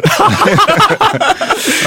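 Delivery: high, hearty cackle; real laughter. Real, not acted. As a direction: burst into high-pitched laughter. Laughs with the others.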